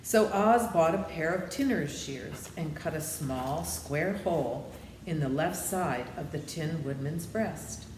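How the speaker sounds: plain reading voice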